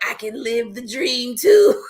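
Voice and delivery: slow, laughing imitation; slow laughing mock of Taman. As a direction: mimic him dreaming, laughing. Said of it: "Taman's like".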